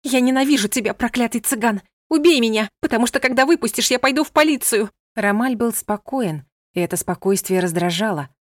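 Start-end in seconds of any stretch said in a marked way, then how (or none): 0.0-4.9 громко и высоко, в ярости; кричи от ненависти; гневный крик. A narrator's reading. (кричит Ромалю в гневе)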